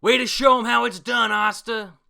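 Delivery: loud, high cheering shout; rousing battle cry; captain's shout. Retold or imitated. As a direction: yell it across the battlefield. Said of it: "Yami shouts out".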